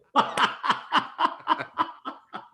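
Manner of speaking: high, breathy burst of laughter; real laughter. Real, not acted. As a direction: crack up at the punchline. Laughing at his own punchline.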